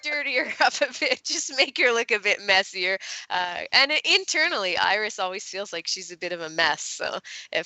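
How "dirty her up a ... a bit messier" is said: higher, giggly asking voice; mock polite request, laughing. Author voicing her request to the cover artist, giggly. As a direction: ask sweetly, laughing at yourself. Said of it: "with the first cover was like".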